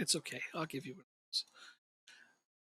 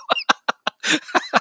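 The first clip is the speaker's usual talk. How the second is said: squealing high laugh; 11 dB louder than usual. Real, not acted. Laughing at own Chelsea jab.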